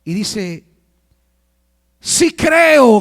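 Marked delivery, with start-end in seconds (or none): 2.0-3.0 high, loud cry of belief, acted; preacher acting out a shout; retold shout. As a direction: call it out as the desperate father. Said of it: "gritó".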